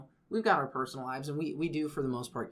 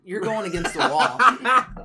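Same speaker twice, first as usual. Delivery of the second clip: laughing it out, slow and loud; cracking up as you picture it; 6 semitones higher, 13 dB louder, much slower than usual. Real, not acted. Friend describing space sex, slow and loud while laughing.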